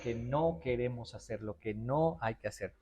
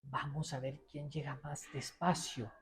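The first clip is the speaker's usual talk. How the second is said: soft slow game voice; 9 dB quieter, slower than usual. Retold or imitated. Slow-motion game with kids.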